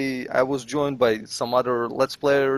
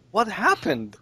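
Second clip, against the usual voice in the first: high-pitched, bewildered question; baffled; 8 semitones higher than usual. Retold or imitated. Viewer reacting to a trick-shot clip, baffled. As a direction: ask it in utter disbelief, voice lifted.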